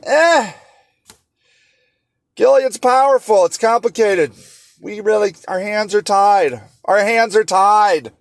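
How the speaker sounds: mock the officials waving it away; dismissive officials. Speaker mocking world powers who shrug off the victims, dismissive. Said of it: "And they're like".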